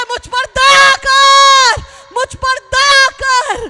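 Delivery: jumping shout. Acted. Blind beggar jumping and crying for mercy, desperate shout. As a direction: scream for mercy with all you have. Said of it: "कूद कूद कर चिल्लाता है".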